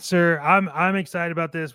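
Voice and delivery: normal talking voice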